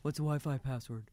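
soft, toneless mumble of a question; imitating indifferent kid. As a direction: skip the hello, ask it quietly. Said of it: "if I got a hello out of that kid first".

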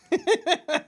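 high, loud burst of laughter; delighted high laugh; real laughter. Real, not acted. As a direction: crack up at your own story. Laughs at son's line.

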